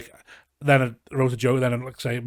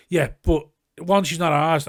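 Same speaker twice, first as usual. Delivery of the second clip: louder, slow indignant retort; retold argument; 5 semitones higher, 6 dB louder, slower than usual. Arguing with his cousin about their nan, loud indignant. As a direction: push back hard but half-mocking.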